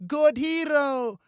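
put-on slow, high, loud toddler cheer; drawn-out baby chant; babbling baby. A narrator's reading. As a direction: babble it like a delighted two-year-old. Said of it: "Baby Annie clapped and babbled".

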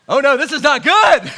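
loud, high hammed-up panic; mock panic. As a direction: shout it like a caught-out sinner in a comic fright. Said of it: "My thought would be".

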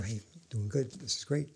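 quiet, gentle reassurance; soft encouragement. Retold or imitated. Director quietly encouraging an actor after a take, soft and gentle. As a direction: speak softly, privately to the actor. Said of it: "uh, softly".

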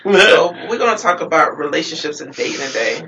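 loud host intro; announcer voice. Real, not acted. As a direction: announce the topic loudly. Host announces topic loudly.

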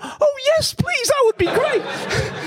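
squeaky falsetto, half crying and half laughing, stretched out slowly; hammed-up desperate gratitude. Founder answering the investor's call, mock tearful overjoyed yes. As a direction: gush like a drowning man thrown a rope. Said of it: "I've slightly exaggerated".

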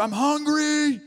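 put-on high whiny child voice; angry hungry child. Voicing his hungry son as an angry child. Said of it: "So angry".

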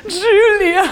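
high, loud panicked yelp of the name; friend's alarmed shriek of the name; panicked cry. Retold or imitated. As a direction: blurt the name out in mock panic. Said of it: "in her own panic about the story".

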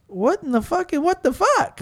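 high, rising incredulous questions; acted bafflement. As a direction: replay your disbelief, pitch climbing. Retells his baffled retort.

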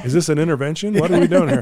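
half-joking rising alarm; 1 mock alarm.

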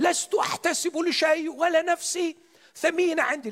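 high, fervent prayer; high pleading prayer voice. Retold or imitated. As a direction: lift the voice high in earnest prayer. Man prays loudly in retold story.

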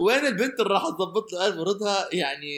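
high, laughing teasing voice. Pretend. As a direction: tease him through laughter.

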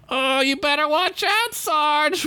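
high sarcastic mock warning; a soldier mocks Demi to the sergeant. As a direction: tease the sergeant. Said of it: "one P O D said".

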